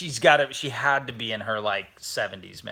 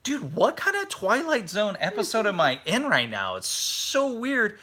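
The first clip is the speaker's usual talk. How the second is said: high-pitched, incredulous reenactment; baffled disbelief; 9 semitones higher than usual. Retold or imitated. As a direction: stretch out the weirdness in mock bewilderment. Acting out own baffled reaction.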